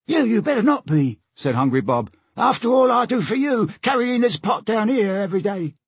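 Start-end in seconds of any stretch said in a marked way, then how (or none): 0.1-1.2 high put-on sailor warning. A narrator's reading. Hungry Bob warns the boys about the grub, high warning voice. 2.4-5.7 high, aggrieved complaint; aggrieved sailor. A narrator's reading. Hungry Bob complains of carrying the pot, aggrieved high voice.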